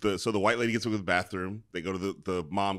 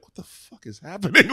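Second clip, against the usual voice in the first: higher, louder incredulous outburst; imitating bewildered onlooker; 9 semitones higher, 7 dB louder than usual. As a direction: mimic a stunned bystander. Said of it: "you can see people like".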